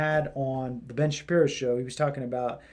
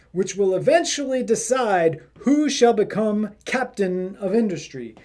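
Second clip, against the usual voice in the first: put-on pompous English accent, higher and louder; 5 semitones higher, 4 dB louder than usual. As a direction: read the quote like a posh Englishman. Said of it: "I gotta do this in the English accent".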